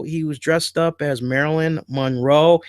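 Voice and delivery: ordinary talk, nothing marked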